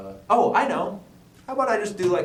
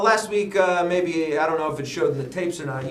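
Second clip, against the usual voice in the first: usual speaking voice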